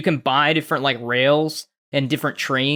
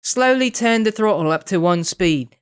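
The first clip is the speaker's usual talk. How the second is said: slow, mock British instructor voice; British lady; 3 semitones higher than usual. British lady instructing a new train driver, slow British accent. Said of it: "the British lady would be like".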